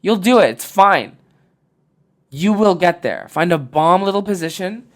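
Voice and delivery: rally them like a coach; loud, high push to try harder at sleeping; fired-up pep talk. Real, not acted.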